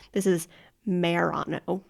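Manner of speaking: usual speaking voice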